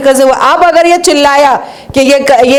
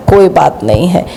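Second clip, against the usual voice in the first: sarcastic dismissal; 7 semitones lower than usual. Retold or imitated. Sarcastic refrain after each charge.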